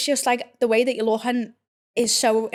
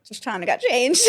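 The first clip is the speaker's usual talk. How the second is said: louder, tipping into a high laugh; breaking into laughter; 9 semitones higher, 5 dB louder than usual. Real, not acted. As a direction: crack up as you finish the line. Laughs at her own retold excuse.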